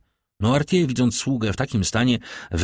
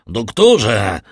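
doktorze called out much louder, urgent; 2 semitones higher, 7 dB louder than usual. A narrator's reading. Villefort shouts louder for the doctor from the doorway. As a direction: raise the second doktorze into a shout. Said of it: "zawołał".

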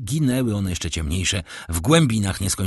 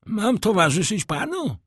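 doorkeeper pitched high; high concierge voice; 12 semitones higher than usual. A narrator's reading. Doorkeeper offers the count light, voiced high.

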